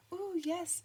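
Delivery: high, gushing little nun voice; chirpy nun. A narrator's reading. Nun greets the new father, high and gushing. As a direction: coo it out like a fussing nun. Sister Mary voiced by narrator.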